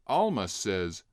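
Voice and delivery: usual speaking voice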